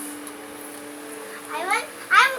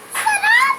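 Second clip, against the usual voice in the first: high squeal of pretend yum; fake delight; 14 semitones higher, 4 dB louder than usual. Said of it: "Joke lang, maanghang". (kunwaring sarap)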